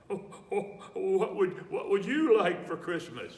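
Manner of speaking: high, loud, chuckling Santa; jolly Santa. A narrator's reading. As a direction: ask it like a beaming Santa Claus. Santa asks boy his wish.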